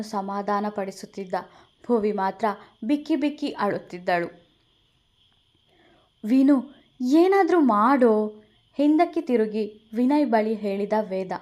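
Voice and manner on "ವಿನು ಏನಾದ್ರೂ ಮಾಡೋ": louder, urgent plea to friend; urgent call. Acted. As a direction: call back to him for help. Veda turns, begs friend. (ಸಹಾಯಕ್ಕಾಗಿ ಜೋರಾದ ಕರೆ)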